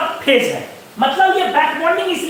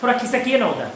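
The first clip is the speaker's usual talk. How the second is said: soft, sweet plea with a lowered voice; lovingly; 4 semitones lower, 5 dB quieter, faster than usual. Pretend. Latecomer asking bench-mate lovingly, soft and sweet. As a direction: coax them gently to shift over. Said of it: "बड़ा प्यार से बोलते हो".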